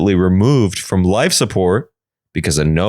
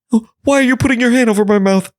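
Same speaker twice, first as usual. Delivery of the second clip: high, fast panicked voice; alarmed sister; 13 semitones higher, faster than usual. Acted. Sister being silenced.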